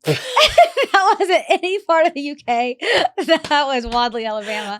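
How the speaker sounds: slow, high laughing cackle. Real, not acted. Friend laughing at her own bad accent, slow and high.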